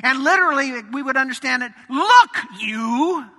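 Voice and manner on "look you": loud, curt "Look, you"; disrespectful retort. Retold or imitated. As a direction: snap it at your dad like a rebellious son. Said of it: "we recognize disrespect when we see it".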